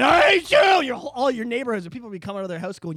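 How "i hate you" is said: hammed-up shouted I hate you; mock movie-scene yell; mock scream. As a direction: scream it like a betrayed Jedi. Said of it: "I'm gonna be screaming".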